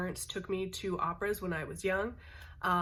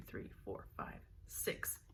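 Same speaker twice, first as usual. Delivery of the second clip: whispered count to oneself; counting under breath; 12 dB quieter, slower than usual. Real, not acted. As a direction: count along very softly, without voice. Counting list items to herself.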